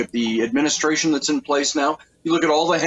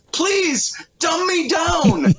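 mock pleading American; 11 semitones higher, slower than usual. Host mocking Americans who want to be dumbed down, high pleading whine. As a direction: ham up a dumbed-down citizen begging to be fooled. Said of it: "Americans are also saying".

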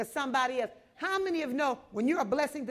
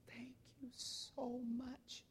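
slow, much softer tearful whisper; 18 dB quieter, much slower than usual. Retold or imitated. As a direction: breathe thanks through tears. Tearful old lady thanks him.

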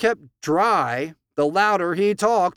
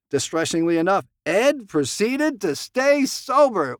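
ordinary talk, nothing marked

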